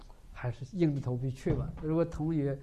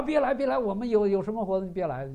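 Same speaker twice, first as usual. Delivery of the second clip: urgent, higher and louder, rushed; classmate brushing her off; 7 semitones higher, 6 dB louder than usual. Acted. Classmate telling the sister to stay away, urgent and rushed. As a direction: shoo her away quickly, pitched up.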